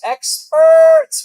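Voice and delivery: high, loud sarcastic cry; mock shout. To the viewers, shouted sarcasm at experts. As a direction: yell it out in mock outrage.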